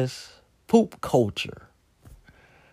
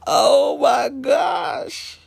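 very slow, high, stretched oh my gosh; exasperated shout; 11 semitones higher, much slower than usual. Real, not acted. Reacting to the mix-up, slow high exasperated cry. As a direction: drag it out in disbelief.

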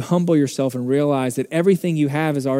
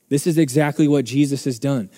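usual speaking voice

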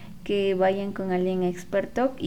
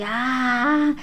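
mild mock scream; 5 semitones higher, slower than usual. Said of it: "gritaba así".

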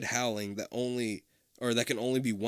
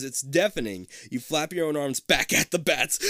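usual speaking voice

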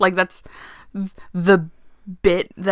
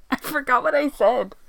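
ordinary talk

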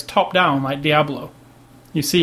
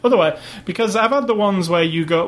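usual speaking voice

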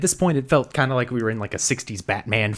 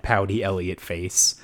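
ordinary talk

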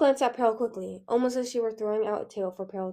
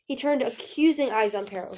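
usual speaking voice

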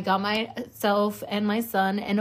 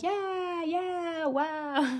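imitating her son; 8 semitones higher, much slower than usual. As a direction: answer like an awed kid, pitched up. Voices her young son's reply.

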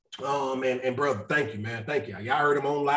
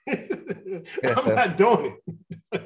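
laughing; 5 semitones higher, much slower than usual. Real, not acted. Joking with a laugh.

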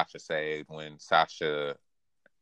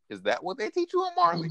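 high, mock-innocent retold dig; sly Kaya jab. Podcaster retelling Kaya's jab at Falco, high mock-innocent. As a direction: slip the question in sweetly, much higher. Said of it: "Kaya just slips in".

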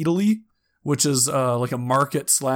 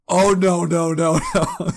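laughing no; 4 semitones higher, 4 dB louder, slower than usual. Retold or imitated. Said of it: "Juliana's like".

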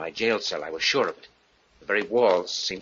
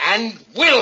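sharp rising loud vow; threatening his wife out loud; loud threat; 11 semitones higher, 5 dB louder than usual. Acted. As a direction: spit out the promise to kill. Frank threatening to strangle her.

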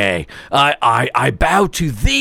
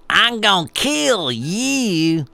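a half-done Southern accent, slowed right down; 3 semitones higher, much slower than usual. Shown as a demonstration. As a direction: stretch "kill" out like "Keel" in a Southern drawl. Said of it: "not quite that Southern accent thing happening".

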